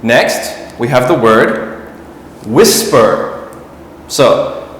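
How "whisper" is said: "whisper" with WHIS punched, second syllable falling; stress on first syllable. Shown as a demonstration. Teacher introducing the next word, first-syllable stress on "whisper". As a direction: hit the front of "whisper". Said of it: "the stress for whisper is in the front".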